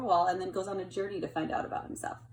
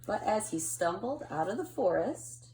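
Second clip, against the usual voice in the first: put-on gentle storytelling read to kids; picture book reading. A narrator's reading. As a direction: read it like to a child at bedtime.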